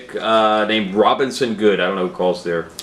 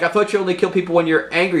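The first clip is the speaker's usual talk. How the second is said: high, fast protest; panicked hostage; 8 semitones higher, faster than usual. Retold or imitated. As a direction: rush it out, pitched up in panic. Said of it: "the guy's like".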